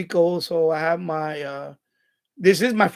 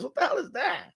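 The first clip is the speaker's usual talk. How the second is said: higher, softer incredulous question; baffled disbelief; 8 semitones higher, 5 dB quieter than usual. Real, not acted. Streamer puzzles over sumo worm farmers, baffled disbelief. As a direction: puzzle aloud over something absurd. Baffled by odd topic he reads out.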